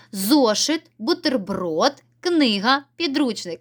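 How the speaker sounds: бутерброд drawn out with a rising, questioning tune; listing with a question rise. Shown as a demonstration. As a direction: hold бутерброд and let it rise as if asking. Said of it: "зайве слово тут яке?".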